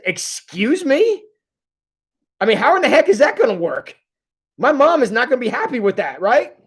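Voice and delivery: mock-bewildered high voice; baffled Nicodemus. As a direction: react like he can't believe it.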